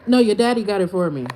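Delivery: fast, loud comeback; loud fast your-daddy retort; loud clapback. Real, not acted. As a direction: slam the troll back quickly. Clapback to a troll.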